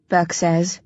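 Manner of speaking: usual speaking voice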